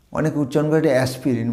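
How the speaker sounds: ordinary talk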